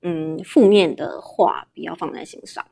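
ordinary delivery

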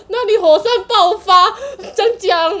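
high, loud, laughing mock alarm; dramatic alarm. Telling the operator about the volcano, hammed-up alarm. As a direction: announce the disaster like a trailer.

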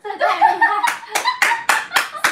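very high, slow, laughing; amazed laughter. Real, not acted. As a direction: laugh it out in disbelief. Amazed at the lip-reading fan.

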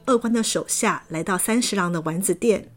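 ordinary delivery, nothing marked

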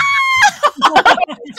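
big high burst of laughter; loud high laughing fit. Real, not acted. Laughter after fan shout.

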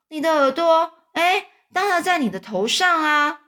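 put-on high, loud puzzled child voice; surprised boy. A narrator's reading. (小男孩惊讶地高声回答)

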